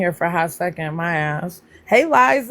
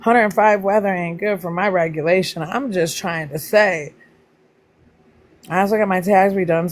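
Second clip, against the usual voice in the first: normal talking voice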